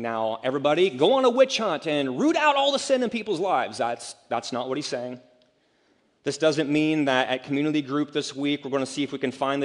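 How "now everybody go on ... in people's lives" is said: much higher, louder, hammed-up mock crusade call; mocking zealot. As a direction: sneer it like a self-righteous witch hunter. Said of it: "I don't think this is Jesus saying".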